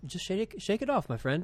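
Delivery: a hammed-up, hushed word of friendly advice; Tom Wright. Podcast host imitating a bishop giving pop-song advice, terrible impersonation. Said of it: "That was my Tom Wright impersonation".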